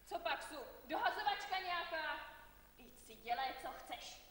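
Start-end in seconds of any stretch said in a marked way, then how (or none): 0.0-2.2 indignant protest. Acted. Aunt rejects blame, indignant.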